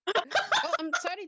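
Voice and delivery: laughing through the line, fast; giggling apology. Acted. Laughing reply in character.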